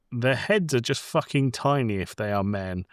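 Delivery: usual speaking voice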